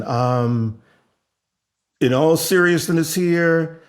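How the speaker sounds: ordinary talk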